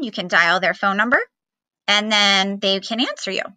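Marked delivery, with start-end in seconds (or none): none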